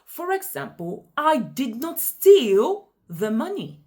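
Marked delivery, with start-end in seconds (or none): 1.2-3.8 STEAL punched high and loud; "I did not STEAL the money"; stress on STEAL. Shown as a demonstration. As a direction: hit the word "steal" hard. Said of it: "I emphasized steal".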